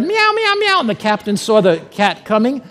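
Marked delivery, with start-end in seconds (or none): 0.0-0.8 very high-pitched imitated meows; cat meow. Preacher voicing the cat in a prison story, high meowing. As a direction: mimic a cat's repeated meow.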